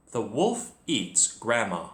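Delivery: ordinary delivery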